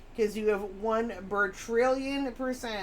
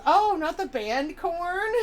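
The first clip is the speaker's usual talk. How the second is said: higher, louder, slow mock-shocked question; mock dismay; 8 semitones higher, 5 dB louder than usual. Voicing [name]'s Korn joke with hammed-up dismay. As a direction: play up the viewer's fake horror.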